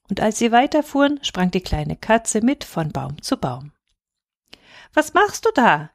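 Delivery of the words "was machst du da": put-on high childish call; boy calling up. A narrator's reading. Boy hails the cat in the tree, calling up. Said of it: "rief der kleine Häwelmann hinauf".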